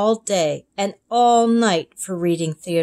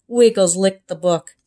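usual speaking voice

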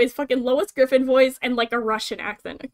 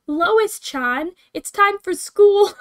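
higher, louder, slower cartoon mom call; sing-song nagging mom calling her kid; Lois Griffin voice; 8 semitones higher, 6 dB louder, much slower than usual. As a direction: Lois voice calling "Lois-chan" up high. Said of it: "reading it in the Lois voice".

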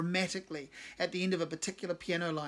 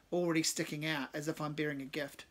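ordinary delivery, nothing marked

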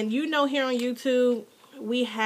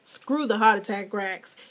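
usual speaking voice

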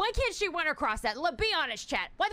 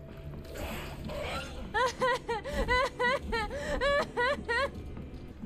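high helpless laugh; 7 semitones higher than usual. Real, not acted. Laughing after being attacked.

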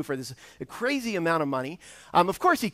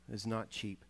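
softer, drawn-out echo of not cheap; softer repeated emphasis; 7 semitones lower, 15 dB quieter than usual. Real, not acted. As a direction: let "not cheap" sink in quietly. Repeats the point for weight.

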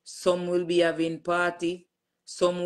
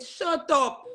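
disbelief; 11 semitones higher than usual. Retold or imitated. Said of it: "I said".

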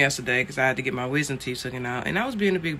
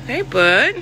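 high, louder rising greeting; doting pet talk; 8 semitones higher, 7 dB louder than usual. Real, not acted. Owner greeting the fluffed-up poodle, doting and high.

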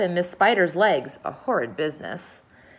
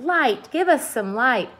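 slow, high-pitched plea; high, insistent cry; 6 semitones higher, slower than usual. A narrator's reading. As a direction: call out for it, drawn out and high. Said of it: "they cried".

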